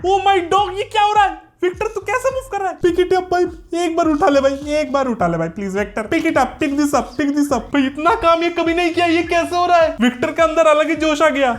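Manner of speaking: high, fast thrilled shouting; real excitement as the robot moves; excited. Real, not acted. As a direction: cheer the robot on, amazed. Robot suddenly moves and works.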